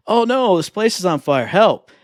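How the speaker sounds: loud, high cry for help; hammed-up game-character alarm; mock panic. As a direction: imitate a villager in distress, playfully. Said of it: "and there's like".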